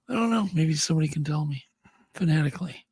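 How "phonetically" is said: usual speaking voice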